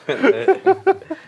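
very high, loud laugh; high shriek of laughter. Real, not acted. Laughing at audience joke.

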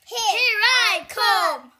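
loud, high, slow battle cry; big drawn-out storybook war cry; charging bellow. A narrator's reading. Big goat charging the troll.

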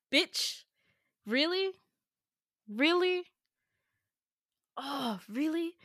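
high, disbelieving really; can't believe he went there; incredulous. Real, not acted. Outraged at cruel improv line.

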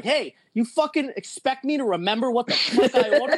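high, laughing mock yell; retold yell at the cashier, partly laughing. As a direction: drunk rant at the cashier, half laughing. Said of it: "Fucking yelling at the cashier".

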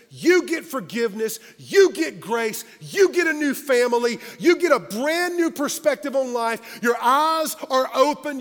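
emphatic, rhythmic pulpit build; rousing preaching list. Real, not acted.

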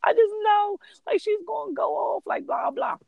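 high-pitched hammed-up wail; mock crying panic. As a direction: imitate a woman fretting through tears. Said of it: "And she's still crying".

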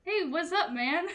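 casual, easygoing mock-demon greeting; laid-back imitation of a chill demon; nonchalant demon. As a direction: greet them like an unbothered devil. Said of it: "totally nonchalant demons who are just like".

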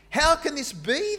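high, loud astonished cry; amazed exclamation. Acted. Bewildered Jerusalem crowd hearing the believers, amazed cry. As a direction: exclaim it in disbelief. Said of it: "they exclaimed".

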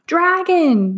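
high loud panicked scream. A narrator's reading. People scream as the dragon crashes out. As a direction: cry out in terror. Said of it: "they screamed".

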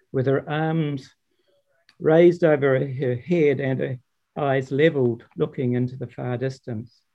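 plain reading voice

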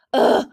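loud self-annoyed ugh; exasperated groan. A narrator's reading. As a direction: groan in frustration. Clearsight disgusted with herself.

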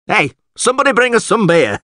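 high-pitched holler for service; calling out. Acted. Alderman hollering to someone for beer, calling out. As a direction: call across the room.

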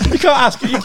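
high, loud laughing protest; laughing while speaking. Real, not acted. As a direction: laugh it out in disbelief. Shocked at dildo answer.

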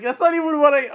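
high, loud frustrated retort; exasperated protest. Acted. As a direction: object in exasperation, breaking off. Daughter exasperated with mom.

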